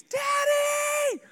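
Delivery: very high, loud, drawn-out shout; high-pitched squealing yell; children's joyful scream. Retold or imitated. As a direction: scream it like kids greeting their dad. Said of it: "the screams".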